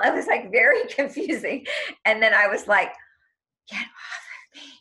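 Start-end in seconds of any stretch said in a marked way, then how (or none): 3.7-4.7 breathy whispered plea. Retold or imitated. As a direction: whisper it urgently. Retold protest, whispered.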